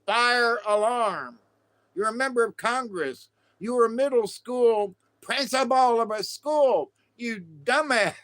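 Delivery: high-pitched, exasperated telling-off; mock scolding. Scolding a congressman over the fire alarm, mock outrage. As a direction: scold him in hammed-up disbelief.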